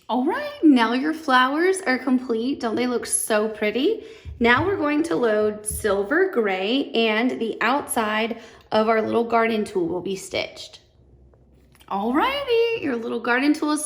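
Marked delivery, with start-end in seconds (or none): 0.1-0.6 high bright sing-song call; cheery high-pitched alrighty. Real, not acted. Cheerful sing-song cue after stitching. 11.9-12.8 high bright sing-song call; cheery high-pitched alrighty. Real, not acted. Cheerful sing-song cue after stitching.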